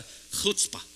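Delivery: "chutzpah" with a guttural KH from the back of the throat; throaty CH. Shown as a demonstration. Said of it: "hit this at the back of your throat".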